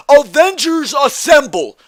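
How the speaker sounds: mocking high-pitched battle cry; Asuka. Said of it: "why is she saying".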